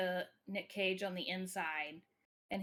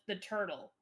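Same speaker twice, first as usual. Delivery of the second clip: usual speaking voice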